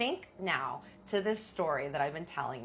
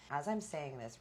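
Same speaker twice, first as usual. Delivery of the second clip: ordinary talk, nothing marked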